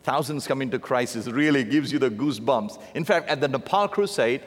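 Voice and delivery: usual speaking voice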